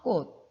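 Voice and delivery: 꽃 ending as kkot, t unreleased; final ㄷ demonstration. Shown as a demonstration. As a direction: model 꽃 with a stopped t, not ch. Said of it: "디귿을 받쳐서".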